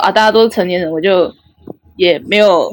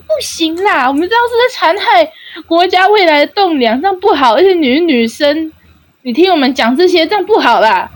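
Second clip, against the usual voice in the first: much higher, fussy protest; mock-righteous refusal; 8 semitones higher than usual. Guy insisting they must stop the late-night talk, high fussy protest. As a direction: insist like a guilty big brother.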